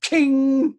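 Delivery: high sharp ching imitating a saw blade. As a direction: ring it out like a blade hitting metal. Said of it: "it's just this noise, like".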